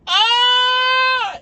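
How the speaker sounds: long, high, loud held scream; drawn-out comic scream of a falling man. Acted. Italian guy tipping over on the hill, long scream. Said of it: "he falls over and you hear".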